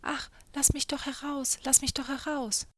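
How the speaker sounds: high, plaintive spirit begging; whiny, miserable begging; pitiful pleading. A narrator's reading. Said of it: "der Geist rief ganz kläglich".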